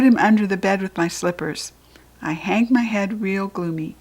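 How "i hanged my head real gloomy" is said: usual speaking voice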